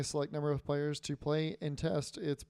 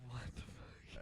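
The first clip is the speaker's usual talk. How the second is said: whispered; 3 semitones lower, 17 dB quieter, slower than usual. Real, not acted. Muttered aside.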